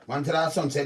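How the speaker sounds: usual speaking voice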